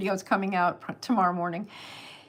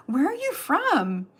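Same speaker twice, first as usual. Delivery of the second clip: higher, slow, drawn-out question; curious American audience member; 7 semitones higher, slower than usual. Retold or imitated. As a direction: ask it high and stretched out, imitating a fan.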